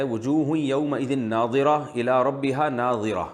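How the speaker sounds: slow, measured Quranic recitation. Acted. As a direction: chant the verse slowly like tilawat. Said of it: "سورہ قیامہ میں جو آیت ہے". (آہستہ قرآنی تلاوت کے انداز میں)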